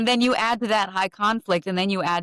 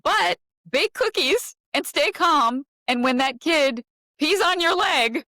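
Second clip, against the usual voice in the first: sarcastic sing-song, pitched far higher and slowed; mock sweet demand; 9 semitones higher than usual. Host mocking what parents are told, sarcastic sing-song. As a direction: mimic society's sugary impossible orders with scorn. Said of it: "and you wanna tell it".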